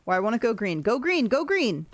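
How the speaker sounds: ordinary talk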